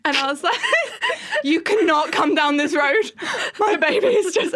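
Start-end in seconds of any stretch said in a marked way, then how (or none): 1.4-4.5 loud, high, half-laughing plea; laughing cry-yell. Retold or imitated. Mother pleading with Santa to spare the sleeping baby, loud laughing cry. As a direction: yell through laughter and tears. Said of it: "I was, like, crying".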